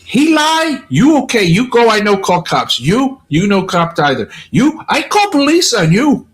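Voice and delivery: scolding guest in high imitated lady voice. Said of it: "She goes".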